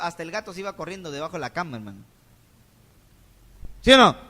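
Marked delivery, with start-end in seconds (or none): 3.8-4.2 loud demand for an answer; calling out. Real, not acted. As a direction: shout it at the pews. Demands response from congregation.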